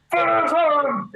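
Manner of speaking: high, slow, muffled mock shout; hammed-up muffled movie version; muffled movie Finish him. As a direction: mimic a voice muffled through a mask. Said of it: "It sounds really muffled".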